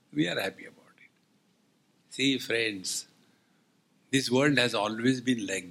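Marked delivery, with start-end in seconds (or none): 0.1-1.1 whispered aside. Acted. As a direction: sly whisper. Mischievous aside.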